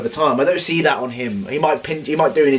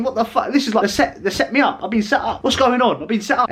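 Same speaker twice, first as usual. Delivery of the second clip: panicked disbelief; 9 semitones higher than usual. Retold or imitated. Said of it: "And I was thinking".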